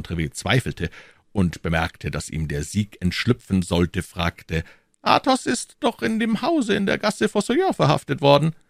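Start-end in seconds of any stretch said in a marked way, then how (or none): 5.0-8.5 high, cool and unhurried cardinal; cardinal's calm voice, raised pitch; phlegmatic cardinal. A narrator's reading. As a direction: say it with utter composure.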